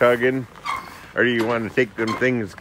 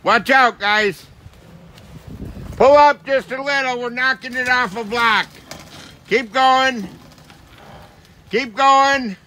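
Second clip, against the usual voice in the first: calling out; 11 semitones higher than usual. Real, not acted. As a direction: shout directions across the yard. Calling to driver from outside.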